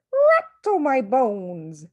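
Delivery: slow, high, eerie croak of a parrot; spooky parrot voice. Acted. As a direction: drag it out like a ghostly bird. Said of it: "giving a wonderful impression of a spooky voice".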